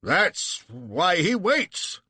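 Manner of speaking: slow, high clipped officer; drawn-out officer's remark; Sale. Acted. Sale answering the prince, slow and high. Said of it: "says Sale".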